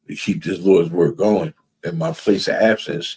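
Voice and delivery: ordinary delivery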